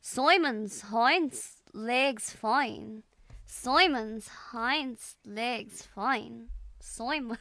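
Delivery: slow put-on Scottish, vowels stretched; Scottish accent attempt. Streamer echoing an accent sample, attempted Scottish. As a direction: repeat it carefully in amateur Scottish. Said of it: "this one's harder than Scottish".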